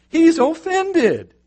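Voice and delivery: high, slow, hammed-up huffy "He's offended."; mock offended. Preacher mocking David's wounded pride, hammed-up and high. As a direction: drag out "He's offended" like a sulking child.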